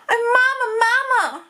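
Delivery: put-on high, loud shriek of a horrified child; hammed-up storybook shriek. A narrator's reading. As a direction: shriek it out like a little girl in horror. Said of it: "she shrieked".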